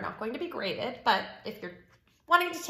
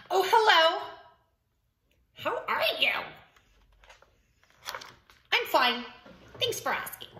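high, coy pigeon voice; lilting high chat bursting into a shout; pigeon character, chatty then thrilled; 8 semitones higher, 3 dB louder than usual. Acted. Pigeon confiding in the reader about a long-held wish, excited.

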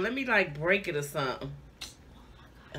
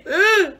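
disgusted groan; 7 semitones higher, 6 dB louder than usual. Real, not acted. Disgust at gross food.

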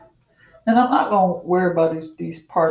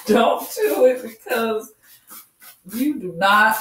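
laughing warning; 5 semitones higher than usual. Real, not acted.

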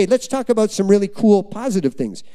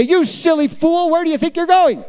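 mock yelling; 8 semitones higher than usual. Preacher showing how God doesn't speak to us, mock yelling. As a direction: scold in mock outrage, pitched way up. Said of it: "He doesn't yell at us and say".

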